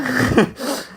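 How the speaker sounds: high giggle mid-sentence; laugh. Real, not acted. After naming the nether regions, amused high giggle. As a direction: break into a high-pitched chuckle.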